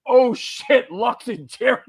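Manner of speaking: starstruck gasp. Retold or imitated. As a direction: blurt it out like you just spotted your idol. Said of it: "I'm like".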